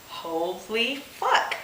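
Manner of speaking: ordinary talk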